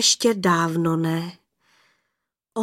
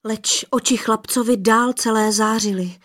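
plain reading voice

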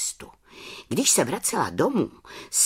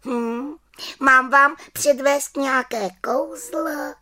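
high little witch offering; 13 semitones higher, 3 dB louder than usual. Acted. Little witch offering the children a magic trick, high. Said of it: "zeptala se malá čarodějnice".

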